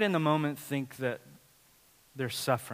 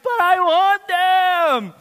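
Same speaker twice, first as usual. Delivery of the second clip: put-on whiny child; 12 semitones higher, 9 dB louder, much slower than usual. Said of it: "you telling your kid".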